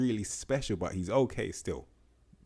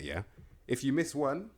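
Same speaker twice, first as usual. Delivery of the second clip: ordinary talk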